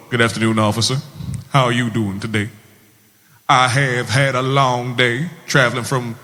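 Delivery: put-on Georgia good old boy in a Southern accent. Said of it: "adopting the southern one".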